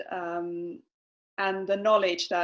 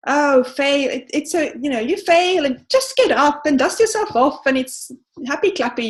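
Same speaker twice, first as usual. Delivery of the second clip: louder, breezy mock-cheerful brush-off; mock cheery; 4 semitones higher, 8 dB louder than usual. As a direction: parody a chirpy pep talk, louder. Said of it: "she's not saying".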